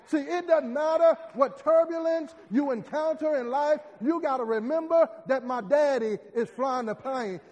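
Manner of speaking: loud, climbing shouted sermon; whooping preacher climax; preaching at full force. Acted. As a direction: preach it to the rafters.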